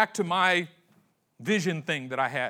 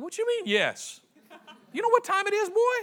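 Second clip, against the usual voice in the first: much higher, put-on voice of a grumpy old man; annoyed old priest. Eli to Samuel at night, a high, put-on grumpy old man voice. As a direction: scold the boy for waking you, voice pitched way up.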